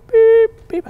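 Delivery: sharp high electronic beep; high beep sound; imitated phone beep.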